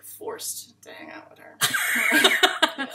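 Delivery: slow whisper breaking into laughs; laughing whisper. Real, not acted. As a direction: giggle it out under your breath. Jokey complaint while laughing.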